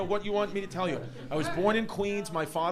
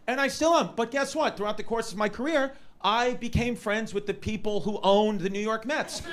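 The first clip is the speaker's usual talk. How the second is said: ordinary talk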